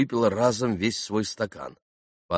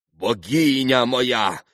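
loud, drawn-out, higher exclamation; crying out; 5 semitones higher, 5 dB louder, much slower than usual. A narrator's reading. Polish gentleman addressing Grushenka, crying out. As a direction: cry out to her slowly. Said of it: "крикнул пан".

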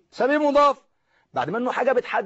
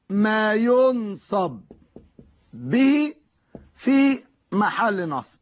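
slow dictation; much slower than usual. Shown as a demonstration. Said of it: "teacher dictates the rule slowly".

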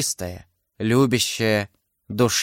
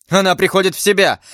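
high, fast exclamation; crying out; 7 semitones higher, faster than usual. A narrator's reading. D'Artagnan seeing his beloved come round, crying out. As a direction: burst out with relieved excitement. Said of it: "вскричал Д'Артаньян".

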